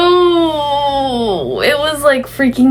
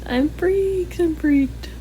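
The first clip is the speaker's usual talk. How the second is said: freaked out; 7 semitones higher, 5 dB quieter, much slower than usual. Real, not acted.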